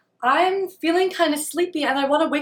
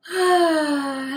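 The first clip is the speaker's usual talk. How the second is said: long open sigh; settling exhale before meditating; relaxed sigh; 4 semitones higher than usual. Real, not acted. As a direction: let out a long, voiced "ahh" sigh.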